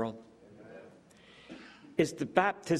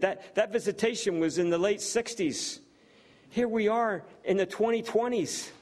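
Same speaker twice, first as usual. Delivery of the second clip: rising preaching fervour; 3 semitones higher than usual. Real, not acted. Preacher presses the congregation about the ministry, in rising fervour. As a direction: pitch climbing higher line by line, preacher in full flow.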